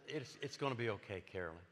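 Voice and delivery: slow, soft, halting reassurance; said really slow. Retold or imitated. Pastor slowly comforting his crying daughter-in-law. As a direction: ease the words out slowly to her. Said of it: "But I said it really slow".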